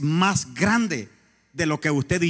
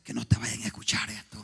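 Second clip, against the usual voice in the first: hushed, anxious whisper; nervous whisper; 6 semitones lower, 8 dB quieter than usual. Retold or imitated. Nervous coworker hushes the pastor at his workplace, whispering. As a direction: whisper urgently so coworkers won't hear. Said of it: "todo nervioso me dice".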